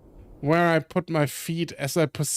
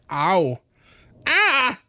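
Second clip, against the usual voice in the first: pained yelp; 3 semitones higher than usual. Acted. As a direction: cry out from a sudden fall. Falls knees first onto rocks.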